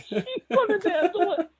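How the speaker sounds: high, laughing to the point of tears. Real, not acted. Telling Joe about the client, laughing tearfully. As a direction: laugh through the words, cracking into tearful glee. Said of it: "I start laughing at her".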